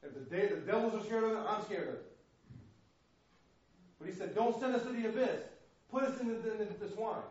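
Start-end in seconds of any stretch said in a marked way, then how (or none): 4.3-7.2 higher, louder begging demons; pleading demons. Acted. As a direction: beg like a cornered demon.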